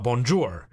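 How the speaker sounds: 'bonjour' as bon-joor with a hard English R; English final R. Shown as a demonstration. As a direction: close 'bonjour' on a full English R. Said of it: "other people that pronounce the R".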